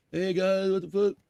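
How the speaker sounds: lightly voiced stoner guy; imitating Andrew.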